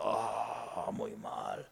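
deflated murmur. Acted. Disappointed believer quoted.

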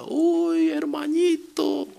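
very high, pleading call to a little brother; girl calling her brother. Retold or imitated. The girl in hell calls out for her dead brother, high and pleading. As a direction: reach up into a thin girl's voice, longing.

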